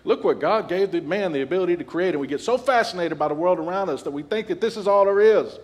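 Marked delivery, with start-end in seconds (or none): 0.1-2.1 mock amazement. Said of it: "It's like".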